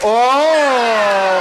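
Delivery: long, drawn-out "oh"; exaggerated, advertised groan of dismay; mistake groan. Shown as a demonstration. Speaker shows how students advertise a mistake, exaggerated long groan. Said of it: "when you make a mistake, you act".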